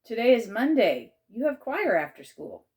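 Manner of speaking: calling out. A narrator's reading. Aunt calling back to reluctant child.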